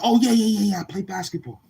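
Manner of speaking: high, loud burst of yeahs; sudden eager recognition; excited realization. Retold or imitated. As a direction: friend finally gets it and answers eagerly. He suddenly catches on.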